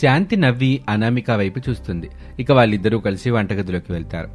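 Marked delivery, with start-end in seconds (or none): none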